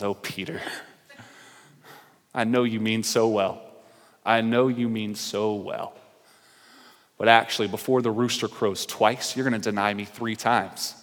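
soft, low, tender voice; gentle reply. Retold or imitated. Jesus gently answering Peter, soft and tender. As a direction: let him down gently.

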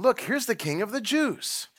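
high sneering mock of a soldier. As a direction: jeer it like a cruel soldier. Soldiers mocking Jesus.

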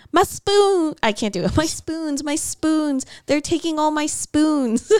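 high, hammed-up Irish wail over stolen spoons; mock Irish lady shrieking for her spoons; Irish accent scream. Friends recalling a film, she wails in a hammed-up Irish accent. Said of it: "screaming in an Irish accent".

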